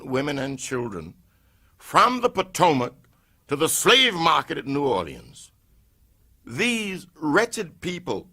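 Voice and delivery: plain reading voice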